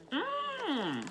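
muffled hums between bites; chewing while talking. Real, not acted. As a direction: mouth partly full. Seen 1: Bites forkful at -2, then closed-mouth chewing while hands work the tray.

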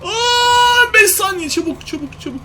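high, loud frantic hurry; panicked shouting. Real, not acted. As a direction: yell at your friend to hurry up. Robot approaching, counting down. (panik içinde bağırarak)

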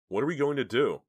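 high, loud, helpless question; alarmed. A narrator's reading. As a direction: ask in rising panic. Dina weak-kneed after frightening call.

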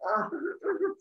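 soft, high little whimper; small high "oh" of dismay. Retold or imitated. Zeus at a loss, looking around.

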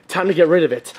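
normal talking voice

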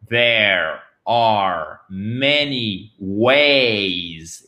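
very slow modelling of "there are many ways"; careful pronunciation. Shown as a demonstration. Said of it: "ce n'est pas there".

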